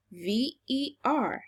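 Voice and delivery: ordinary talk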